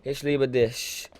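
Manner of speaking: slow, careful "Ich liebe dich" with each word drawn out; careful German. Shown as a demonstration. Rapper slowly repeats a German phrase for his German fans. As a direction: say it slowly like a learner. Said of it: "teach you something in German".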